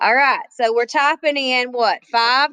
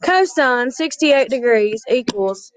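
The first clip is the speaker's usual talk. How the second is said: usual speaking voice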